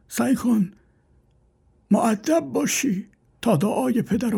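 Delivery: put-on high old man's voice pleading; old father warns son about bad friends; frail old father. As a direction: beg the son gently as an old man.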